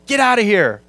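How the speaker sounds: offended demon. Retold or imitated. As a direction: throw it out like a bothered demon. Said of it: "He's offended. He's provoked".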